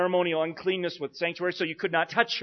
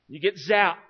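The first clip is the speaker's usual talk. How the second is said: ordinary talk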